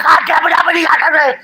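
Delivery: high, loud mock yell at Uppity; imitating her yelling. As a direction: squawk it like she's losing it. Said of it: "She's going".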